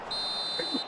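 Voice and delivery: a quick breathless laugh; sheepish laugh after apologising to Omar; soft laugh. Real, not acted. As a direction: let a quiet laugh slip out.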